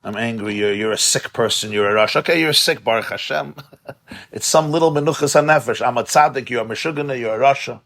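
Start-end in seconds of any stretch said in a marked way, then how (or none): none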